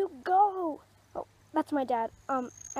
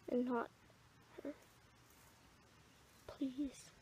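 hushed plea; 2 semitones lower, 10 dB quieter than usual. Acted. Mother prays for her daughter.

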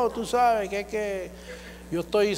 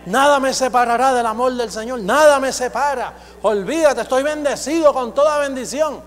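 higher, louder declaration; bold confession; 4 semitones higher, 6 dB louder than usual. Shown as a demonstration. Preacher voices a confident believer's loud confession. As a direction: proclaim it with full conviction. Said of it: "abrir la boca y comenzar".

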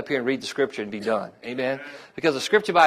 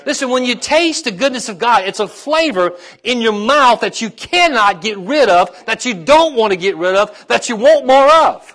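high, loud, building to a peak; full-force preaching; 6 semitones higher, 6 dB louder than usual. Real, not acted. Preacher tells congregation of God's flavor, building impassioned. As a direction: drive each repeated phrase harder, stretching the last.

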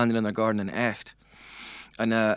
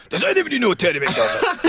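high-pitched imitated call to a room; mock announcement; 8 semitones higher, 3 dB louder than usual. Journalist walking into the village bar, mock announcing. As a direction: mimic a reporter announcing a question to everyone. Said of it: "went into the bar and announced".

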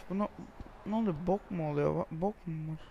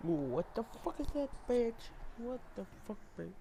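put-on English exclamation; 5 semitones higher than usual. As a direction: play up the disbelief in English. Mock English reaction to game.

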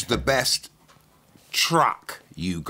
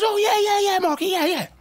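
very high, loud mock-female voice; flustered hostess; 4 dB louder than usual. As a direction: squeal it as an over-eager hostess. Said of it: "She goes".

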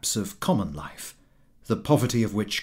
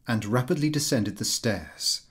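ordinary delivery

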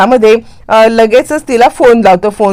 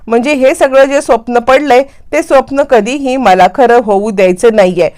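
ordinary delivery

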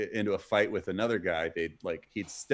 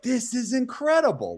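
giddy amazement; 12 semitones higher, 4 dB louder than usual. Retold or imitated. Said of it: "we're just sitting here like".

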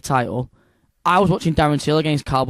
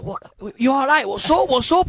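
high-pitched urgent questioning, imitating a mate; mate's questioning; 10 semitones higher than usual. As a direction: act out a mate asking what's up, pitched high. Acts out mate's worried questions.

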